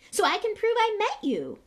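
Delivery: put-on very high, loud eager boy. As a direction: gush like a starstruck young fan. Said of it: "Creevey eagerly".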